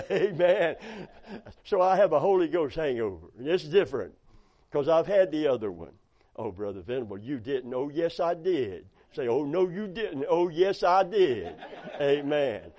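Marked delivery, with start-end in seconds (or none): none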